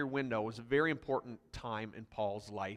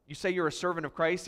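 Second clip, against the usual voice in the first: usual speaking voice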